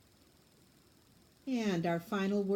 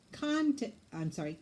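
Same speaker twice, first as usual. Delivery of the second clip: ordinary delivery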